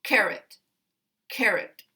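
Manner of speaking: "Carrot" with CAR strong, second syllable reduced to schwa; strong-weak stress. Shown as a demonstration. Teacher showing a weak second syllable, "carrot" stressed on CAR. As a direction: stress the start of "carrot", let the ending weaken to uh. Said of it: "primary stress on the first syllable".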